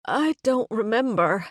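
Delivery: high, tearful whine; whiny tearful plea. Pretend. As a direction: whimper it out, close to tears. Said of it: "add tears and a whiny note to my voice".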